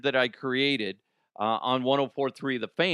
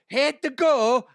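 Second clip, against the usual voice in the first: high, loud cartoonish squeal; mock-broken high yelp; goofy high squeal; 12 semitones higher, 6 dB louder than usual. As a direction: blow the line up into a silly shriek. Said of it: "I don't know words".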